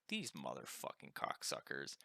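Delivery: low, soft under-the-breath cursing; muttered curse. Retold or imitated. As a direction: grumble it to yourself, seething. Fuming retold curse at cops.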